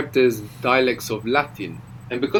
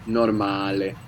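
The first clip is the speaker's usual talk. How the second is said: "normale" stressed on a long open A; nor-MAH-leh, leaning on the A in "normale"; stress on second-last syllable; 2 semitones lower, slower than usual. Shown as a demonstration. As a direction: clear Italian "normale", weight on MA. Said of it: "we have the accent on the second last syllable".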